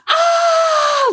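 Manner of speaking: sudden high shout of ah; jump-scare yell, very high and loud. Retold or imitated. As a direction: leap out yelling to scare. Cast member jump scare yell.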